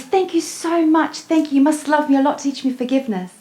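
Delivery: overjoyed little soul thanking her; grateful soul. Retold or imitated. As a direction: pour out thanks like a delighted child. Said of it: "the other soul goes".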